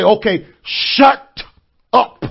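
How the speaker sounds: slow "Okay", then a pause, then a loud "up"; slow stressed shut up. Retold or imitated. Husband telling his wife, slowly stressing shut up. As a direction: drag it out, then land on "up". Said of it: "When my wife getting on my nerves".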